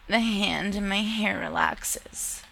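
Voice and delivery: ordinary delivery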